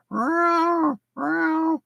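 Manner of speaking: high mock cat meows; cat meowing. A man telling of his baying cats, imitating cat meows. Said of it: "They were baying, like, going".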